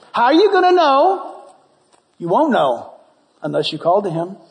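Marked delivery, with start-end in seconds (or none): none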